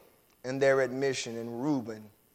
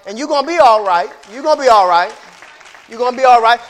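reassuring; 3 semitones higher, 9 dB louder, faster than usual. Acted. Repeated reassurance crescendo.

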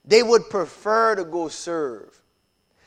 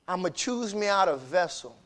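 ordinary delivery

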